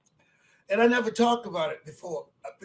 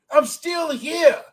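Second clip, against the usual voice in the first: loud, higher, slow and emphatic; defiant declaration; 5 semitones higher, 3 dB louder, slower than usual. Real, not acted. Old speaker defying those who count him out, slow loud declaration. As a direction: declare it like a battle cry.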